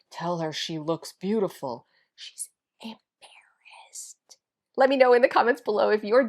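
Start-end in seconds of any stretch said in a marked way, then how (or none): 2.2-3.0 hushed whisper; very soft whisper, no voice; whispered aside. Real, not acted. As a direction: confide it in a whisper. Confides dog's shame in whisper.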